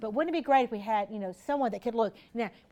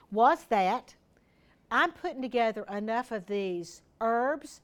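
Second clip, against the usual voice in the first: usual speaking voice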